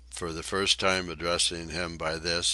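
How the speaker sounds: ordinary delivery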